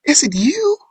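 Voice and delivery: very high, incredulous questions; mind-blown. Retold or imitated. Brothers asking if it is Yusuf, astonished. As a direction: let the pitch leap up in disbelief. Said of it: "These brothers are mind blown here".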